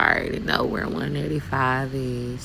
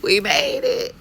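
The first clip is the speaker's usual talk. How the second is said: relieved cheer. Real, not acted. Relief after tight merge.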